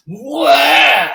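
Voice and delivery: loud high newborn wail; sudden high baby wail; baby cry. Retold or imitated. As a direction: cry out like a baby's first sound. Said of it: "baby that goes".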